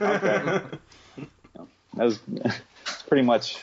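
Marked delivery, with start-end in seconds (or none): 0.0-0.6 squeaky resigned agreement; a high, laughing give-in; high meek okay. Retold or imitated.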